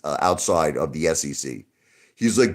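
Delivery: normal talking voice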